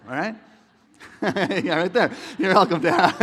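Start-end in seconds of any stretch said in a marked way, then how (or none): 1.2-3.2 loud laughing through the words. Real, not acted. Pastor laughing out loud at his own bit. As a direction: crack up while talking.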